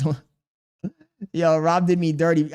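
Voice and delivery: usual speaking voice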